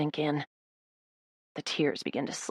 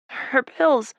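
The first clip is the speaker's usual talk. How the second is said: choking back tears; 7 semitones higher, 3 dB louder than usual. Acted. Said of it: "choking back more tears".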